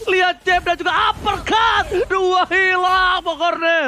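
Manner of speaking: high, loud climax call; esports caster shouting. Acted. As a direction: scream the kills out. (teriakan komentator esports)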